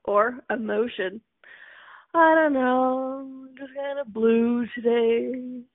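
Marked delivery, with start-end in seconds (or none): 2.1-5.6 slow, drawn-out mock moping; mopey, mock blue. Acting out feeling kinda blue today, mock mopey. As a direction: drag it out like someone lightly hamming up the blues.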